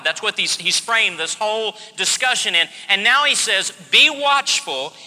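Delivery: loud, driving pulpit proclamation; fervent preaching. Acted. Preacher proclaiming to the congregation that God is working, loud and fervent. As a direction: hammer each phrase to the congregation.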